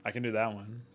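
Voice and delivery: ordinary delivery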